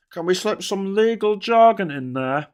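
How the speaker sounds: high, loud sly scheming voice; a hammed-up schemer asking to sneak it in; mock Prime Minister. Mocking the Prime Minister planning his speech, sly and loud.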